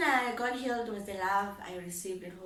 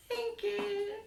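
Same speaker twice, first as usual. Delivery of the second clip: high thank you through a giggle; giggly coy thanks; 13 semitones higher than usual. Retold or imitated. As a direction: laugh it off, flattered. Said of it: "I did exactly how I would do".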